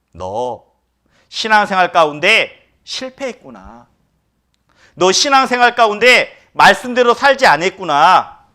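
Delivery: lightly voiced Jesus, pressing the point; Jesus gently rebuking. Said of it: "이렇게 말씀하시는 겁니다".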